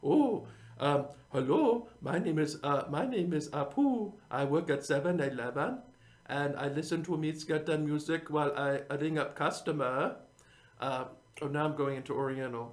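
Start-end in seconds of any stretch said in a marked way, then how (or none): 0.0-10.1 mock Indian accent, pitch jumping up on hello; a hammed-up Indian shopkeeper voice; Indian accent impression. To his audience, showing off his Indian impression in a mock Indian accent. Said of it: "my Indian impression".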